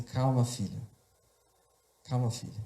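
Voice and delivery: low, soft retold reassurance; calming mother. Mother coming close to her screaming son, softly calming. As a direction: soothe a frightened child quietly. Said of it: "essa voz se aproxima e diz assim".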